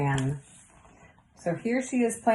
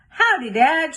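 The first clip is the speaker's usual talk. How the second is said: higher, louder call; 7 semitones higher, 5 dB louder than usual. A narrator's reading. Girl calling out to her dad, higher and louder. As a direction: call cheerfully across the kitchen. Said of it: "she called".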